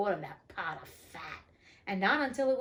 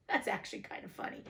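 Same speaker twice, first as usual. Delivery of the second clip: ordinary delivery, nothing marked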